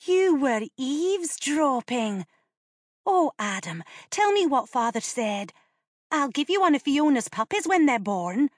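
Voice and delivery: put-on high, coaxing young girl; wheedling girl. A narrator's reading. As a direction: bribe him sweetly.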